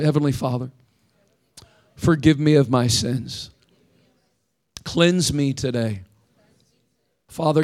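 prayer for the congregation to repeat; led prayer. Acted. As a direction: pray it line by line, firm and measured. Leads a group prayer.

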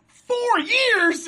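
high, loud startled question; shocked disbelief. Retold or imitated. Retelling Adam Cole reading the calendar, high shocked question. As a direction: blurt it out in mock shock. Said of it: "He's like".

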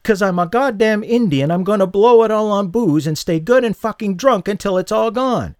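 high, loud furious snap; a woman insulted, snapping high and loud. A narrator's reading. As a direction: lash out at him, stung. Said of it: "she snapped".

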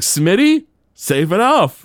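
high, loud mock Homer; Homer's reply. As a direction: toss it off in a squeaky cartoon voice. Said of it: "He's like".

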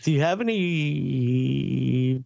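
slow, stretching out the words before the last; hesitant drawn-out question. Retold or imitated. Co-host imitating Larry asking the store for paint, hesitant drawn-out question. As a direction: stall on it, imitating an awkward customer.

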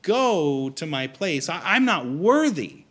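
high, loud, stumbling objection; flustered protest. Acted. Centurion telling Jesus he is not worthy, flustered. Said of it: "He stumbles and he goes".

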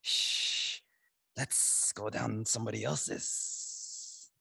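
slow whispered sneaky snake; hushed, slow, sly snake. A narrator's reading. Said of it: "The snake".

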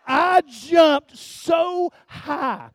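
loud, high, drawn-out exclamation; big slow storyteller's outburst; startled retelling. Retold or imitated. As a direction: stretch it out, reliving the jolt. Reliving the jump of fright.

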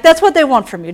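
ordinary talk, nothing marked

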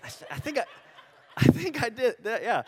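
sheepish laugh through the words. Retold or imitated. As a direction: giggle as you admit it. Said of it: "Some of you are like".

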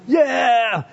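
high mock cheer; fan cheering. Preacher imitates one winning fan, high cheer. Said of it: "those guys that are going".

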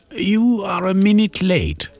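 high, clipped gentleman's reproach; Velveen's voice. Acted. Club member scolds a latecomer, high prim voice. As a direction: chide him for being late.

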